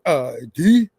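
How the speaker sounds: low, drawn-out demand for ID; mock drill instructor command; boot camp sergeant. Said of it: "a boot camp scene or something".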